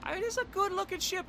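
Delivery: high, soft put-on Russian accent; hammed-up Russian accent admiring the ship; mock Russian accent. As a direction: gush over the ship like a comrade. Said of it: "my horrible attempt at a Russian accent".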